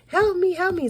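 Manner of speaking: high mock yell for help; retold cry for help. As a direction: imitate a friend calling for help. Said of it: "I start hearing her yelling".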